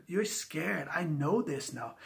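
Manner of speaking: normal talking voice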